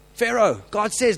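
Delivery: slow, loud call across the river; shouted call. Retold or imitated. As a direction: call out to Pharaoh on his barge. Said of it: "he shouts to him and says".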